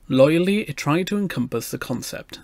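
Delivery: ordinary delivery, nothing marked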